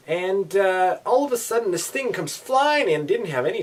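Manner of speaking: sudden jump up in pitch and volume; startled retelling. Retold or imitated. Driver retells a bug flying in, startled. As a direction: build the shock of the moment.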